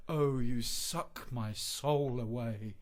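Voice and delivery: swooning recital. Acted. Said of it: "Here are some lines I've been working on".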